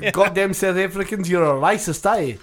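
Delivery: mock South African accent, loud; South African accent, teasing. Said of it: "I do a South African accent every time I can".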